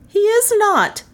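very high, emphatic woman's retort; high-pitched adamant woman; indignant contradiction. A narrator's reading. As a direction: flatly deny it in a high voice. Melissa contradicts the lawman.

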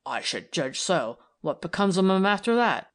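a hint of a skeptical policeman; Tom's voice. Tom's gruff male voice.

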